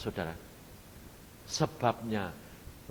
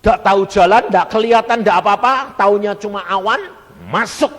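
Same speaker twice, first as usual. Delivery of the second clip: loud, high, insistent run; fervent preaching; 8 semitones higher, 18 dB louder than usual. Acted. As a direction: drive each phrase home at full volume. Preaching surrender at full force. (khotbah berapi-api dengan suara lantang)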